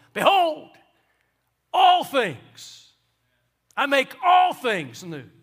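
Behold, all things, I make all things new, shouted high and loud; proclaiming like fireworks. Shown as a demonstration. Preacher bursts out with Jesus' words to the congregation, ringing shout. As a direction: blast it out like fireworks. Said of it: "It's like fireworks going off".

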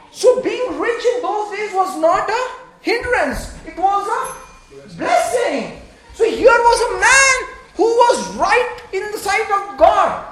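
impassioned pulpit delivery; fervent preaching. Acted. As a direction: build the case to the congregation. Preaching at full force.

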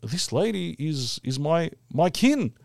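drawn-out realisation of finding his own people; slow wonder. Retold or imitated. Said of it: "And I'm thinking".

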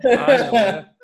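loud, high laughing exclamation. Real, not acted. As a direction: blurt it out mid-laugh. Laughing after fake sneeze wait.

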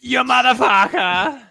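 very high shrieked insult in a put-on accent; shrieking movie quote. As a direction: scream it in a wild accent. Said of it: "all of the accents that are involved".

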